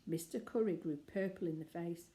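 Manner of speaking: ordinary delivery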